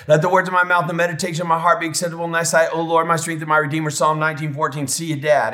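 imitating boys gabbling a memory verse; rote family recitation. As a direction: race through it like kids reciting by heart. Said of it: "And we'd all say".

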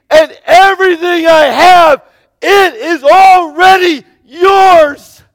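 high, loud pleading cry; impassioned father. Acted. Father pleading with his older son, loud and heartfelt. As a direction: pour out love to the son.

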